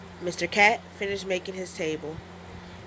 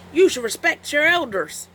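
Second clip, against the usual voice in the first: high cat voice, stern; Mister Cat lecturing; 8 semitones higher, 3 dB louder than usual. Acted. Mister Cat lectures the wolf, high stern voice. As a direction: lecture the youngster. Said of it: "said Mister Cat".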